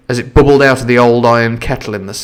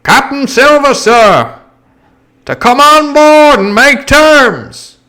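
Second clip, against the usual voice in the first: high, slow, louder sailor voice; generic sailor's shout; 5 dB louder, slower than usual. Acted. Sailor shouting terms to the captain, rough voice. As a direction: bellow it like a common deckhand. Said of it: "he shouted".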